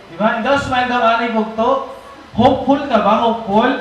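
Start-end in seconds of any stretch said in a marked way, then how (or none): 2.4-3.7 louder, higher call to the crowd; preacher rallying the room; rousing question. Real, not acted. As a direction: stir them up with the question. Preacher challenging the audience.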